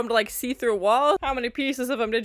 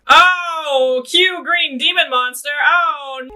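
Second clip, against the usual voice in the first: loud, high-pitched exclamation; startled excitement; 6 semitones higher, 7 dB louder, much slower than usual. Real, not acted. Viewer reacting to a green demon monster appearing, startled excitement.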